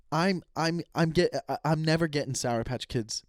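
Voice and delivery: ordinary delivery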